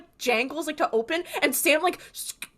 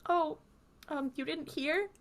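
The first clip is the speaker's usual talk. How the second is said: higher, softer mock-tearful voice; lightly acted tearful hesitation; upset, tearful roommate; 5 semitones higher, 7 dB quieter than usual. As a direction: imitate someone tentative and close to crying. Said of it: "she starts crying, and she's like".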